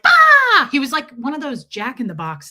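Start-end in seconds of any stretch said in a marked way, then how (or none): 0.0-0.7 sudden high, loud shriek; short burst of mock screaming. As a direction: pop out with a piercing imitation scream.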